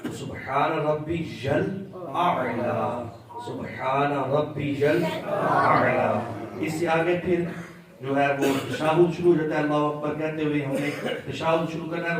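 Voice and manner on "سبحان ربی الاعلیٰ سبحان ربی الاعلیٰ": very slow "سبحان ربی الاعلیٰ" with pressed ain; teacher's deliberate, stretched-out demonstration; model recitation. Shown as a demonstration. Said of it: "اعلیٰ اس طرح پڑھ رہا ہے".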